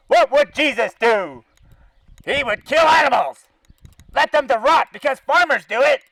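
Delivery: ordinary talk, nothing marked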